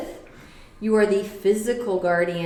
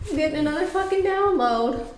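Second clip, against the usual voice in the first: excited; 8 semitones higher, faster than usual. Real, not acted. Sudden excited download.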